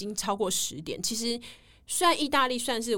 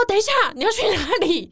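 much higher, louder call of alarm; alarmed shout; 11 semitones higher, 7 dB louder than usual. Retold or imitated. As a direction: stop him as he walks off. Alarmed shout at departing man. (惊慌地大声喊住他)

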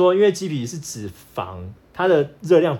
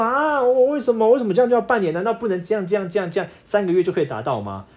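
high, whiny mock protest; imitating a wife wailing over a diet; mock wailing complaint; 6 semitones higher than usual. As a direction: ham up a wife's dramatic whining. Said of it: "她就开始哭天喊地说".

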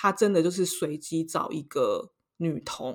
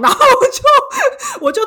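much higher and louder, slow, laughing through the words; 12 dB louder, slower than usual. Real, not acted. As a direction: laugh as you remember running off. Laughing while recalling the escape. (边笑边说，声音高而响)

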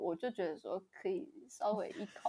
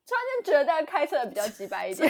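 laughing; 7 semitones higher, 8 dB louder, faster than usual. Real, not acted. Laughing at the driver.

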